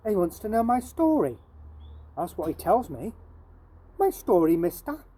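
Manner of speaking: lively storytelling read aloud to a class; reading a Victorian street boy's tale to pupils; story narration in Jim's voice. A narrator's reading.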